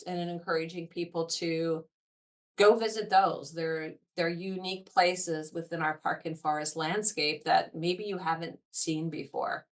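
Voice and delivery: usual speaking voice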